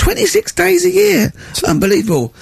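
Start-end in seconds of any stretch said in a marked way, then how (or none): 0.0-1.3 indignant repeat. Real, not acted. Outraged repeat of boss's holidays.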